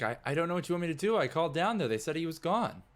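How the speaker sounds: high, fast flustered explanation; flustered guard. Acted. Guard defending himself to the crying character. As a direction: rush it out, defensive.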